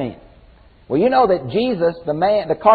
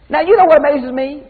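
excited; 10 semitones higher, 4 dB louder than usual. Real, not acted. Excited aside to congregation.

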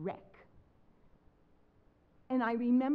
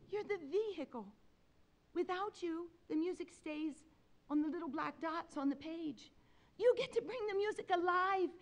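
put-on high, fervent Spanish-accented teaching; imitated Spanish-accented pep talk; Julio Esteban; 7 semitones higher than usual. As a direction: inspire like an impassioned Spanish professor. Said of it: "in his impeccable Spanish accent".

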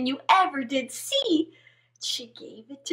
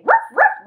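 barking dog; 8 semitones higher, 5 dB louder than usual. Acted. Frog barks.

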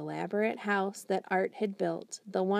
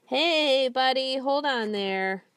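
higher, louder call to stop; 5 semitones higher, 6 dB louder, slower than usual. Acted. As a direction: call out to halt him. Janitor calls to escaping hamster.

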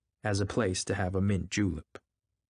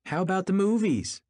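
high, loud call; crying out; 12 semitones higher, 5 dB louder than usual. A narrator's reading. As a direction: call out the question in a raised, high voice. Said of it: "she cried".

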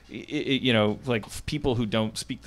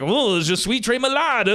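higher-pitched imitation of fluent French; mock fluent French; 9 semitones higher, 3 dB louder than usual. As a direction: imitate a wife phoning in French. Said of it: "like, fluent French".

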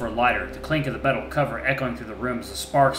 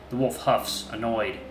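usual speaking voice

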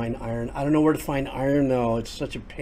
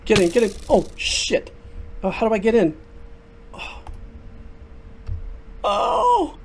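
high, loud panicked yelling; real panic as the zombie chases you; panicked shouting; 5 dB louder than usual. Real, not acted. Zombie attack, panicking in game.

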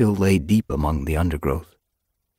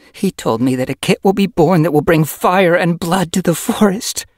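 high, loud, fearful urgency; shaking hiss; 12 semitones higher, 8 dB louder than usual. Acted. Yellow Fang tells Silver Flame of the fire prophecy, fearfully. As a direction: let the dread of the prophecy spill out. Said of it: "hissed, her voice shaking".

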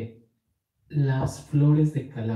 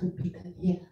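whispering; 6 semitones higher, 5 dB quieter, faster than usual. Shown as a demonstration. Whispered name again.

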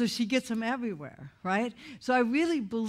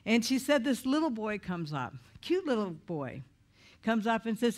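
ordinary talk, nothing marked

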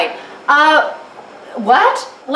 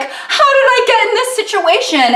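much higher, whiny fake sob; mock crying; 11 semitones higher than usual. Stuck mid rickety bridge, hammed-up crying wail. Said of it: "I'm like".

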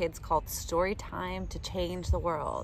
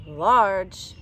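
large said louder and bigger; big booming word; 2 semitones lower, 7 dB louder than usual. Acted. Reading sizes to kids, louder and bigger. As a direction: make it sound big.

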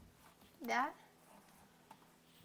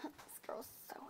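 whispering; 4 semitones higher, 12 dB quieter than usual. Real, not acted. Whispered aside about sister.

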